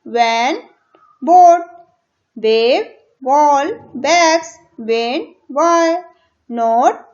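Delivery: each word called out loud and punchy; reading words aloud. Shown as a demonstration. Teacher reads the word list aloud for pupils to repeat. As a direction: bark each word out clearly for the class. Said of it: "इसको तेजी तेजी से बोल बोल के पढ़ना है".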